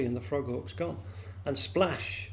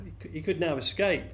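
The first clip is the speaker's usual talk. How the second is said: usual speaking voice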